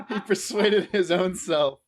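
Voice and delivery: laughing loudly through the line; amused, loud and laughing. Real, not acted. Laughing at co-host's reversal.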